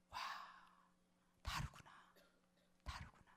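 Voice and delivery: hushed, awed whisper; amazed whisper. Retold or imitated. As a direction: whisper it as if stunned by a discovery. Retelling own amazement. (놀라서 속삭이듯)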